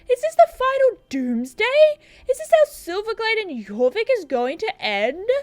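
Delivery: high, hammed-up panic; councilman voice. Councilman fearing doomsday in the village, theatrically alarmed. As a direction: lament like a melodramatic village official. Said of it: "what voice do I do for the councilman".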